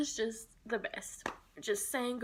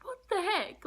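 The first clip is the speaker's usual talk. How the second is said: much higher, a retold gasp of disbelief; shocked disbelief; 11 semitones higher than usual. Retelling the dog's sudden collapse to viewers, much higher stunned disbelief. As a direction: replay the moment the dog collapsed, stunned. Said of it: "looked at each other like".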